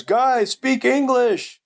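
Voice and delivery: high, drawn-out yell without much volume; retold yell. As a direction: imitate calling to the kids from upstairs. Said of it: "yelling from upstairs".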